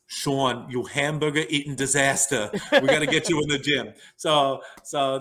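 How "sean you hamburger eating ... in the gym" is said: mocking producer. Producer mocking his body.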